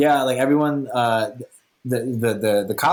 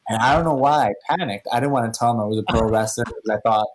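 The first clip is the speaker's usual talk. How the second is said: normal talking voice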